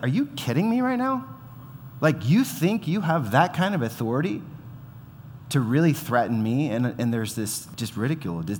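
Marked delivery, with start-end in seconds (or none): 0.0-6.7 mocking disbelief. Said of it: "God's like".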